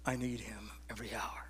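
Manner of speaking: whispered, very soft and low; a hushed plea to God. Real, not acted. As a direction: breathe it out as a confession. Confession of need to congregation.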